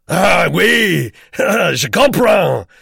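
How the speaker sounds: loud, high, jolly Swiss man; exuberant, high-pitched French exclamation; Herr Vogel. A narrator's reading. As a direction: boom out delighted understanding in French. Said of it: "said Herr Vogel".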